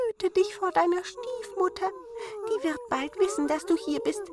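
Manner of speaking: hohe Zwergenstimme, besorgt; hoch und eindringlich warnen; warnende Zwerge. A narrator's reading. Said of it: "warnten es die guten Zwerglein". (hohe, besorgte Zwergenstimme)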